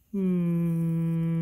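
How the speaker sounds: one long held "moo"; long cow moo. Shown as a demonstration. Teacher voicing the cow again, a long held moo. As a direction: stretch out a cow's moo.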